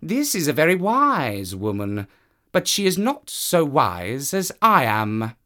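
high, smug cat voice; a cat thinking he's wiser. Acted. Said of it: "said the cat, listening".

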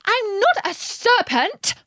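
high, drawn-out indignant denial. A narrator's reading. Alice answering the pigeon's accusation, indignant. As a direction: protest in a high, offended voice. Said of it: "said Alice indignantly".

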